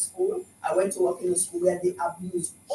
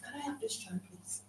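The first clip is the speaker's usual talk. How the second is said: quiet request; 19 dB quieter than usual. Retold or imitated. Retold calm request.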